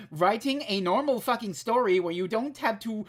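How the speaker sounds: loud, exasperated rant climbing in pitch; worked-up tirade with a high, loud finish; getting heated. Real, not acted. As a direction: plead furiously, building to a shout. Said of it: "Getting heated".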